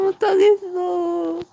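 high, slow scared whimper; too frightened to go on; scared whine. Real, not acted. Too scared to pass monster.

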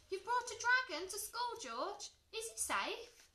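high, loud, alarmed questioning; shocked. A narrator's reading. Teacher to George about the dragon, shocked and high-pitched. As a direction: gasp it out in disbelief. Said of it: "gasped Miss Perks".

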